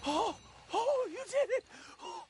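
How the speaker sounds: high relieved gasps; overjoyed he's free; relieved, excited. Acted. Freed from the strap.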